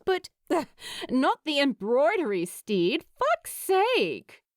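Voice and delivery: fed up, almost shouting the swear; exasperated groan. Acted. Lou correcting Steed, loudly exasperated. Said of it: "Lou groaned".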